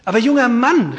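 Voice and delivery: high, loud pleading call. Acted. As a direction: call out to win him over. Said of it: "rief der Gouverneur".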